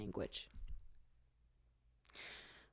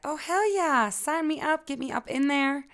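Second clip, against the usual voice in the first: higher and louder, all in; eager listener; 9 semitones higher, 8 dB louder than usual. Retold or imitated. Listener eager to sign up, higher and louder. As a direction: imitate a listener shouting yes. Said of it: "you're like".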